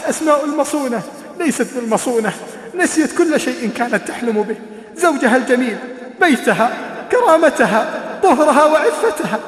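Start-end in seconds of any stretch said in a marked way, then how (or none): 5.0-9.4 rising lament. A narrator's reading. Preacher laments lost honour.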